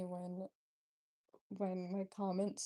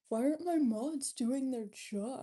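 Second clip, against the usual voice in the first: loud, high, slow mock outrage; mock complaint; 4 semitones higher, 8 dB louder than usual. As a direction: jokingly call out the slackers, drawn out loud. Joke complaint, then "just kidding.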